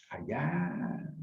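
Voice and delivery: ordinary talk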